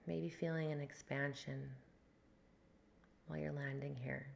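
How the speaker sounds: guided meditation. Acted. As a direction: ease them into the breath. Leads a breathwork meditation.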